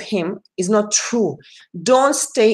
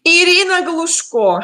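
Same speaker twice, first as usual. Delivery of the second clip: excited greeting; 9 semitones higher, 4 dB louder than usual. Real, not acted. Greets a friend joining stream.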